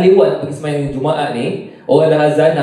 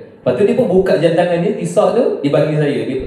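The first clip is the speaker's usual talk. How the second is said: ordinary delivery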